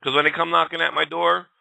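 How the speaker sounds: ordinary delivery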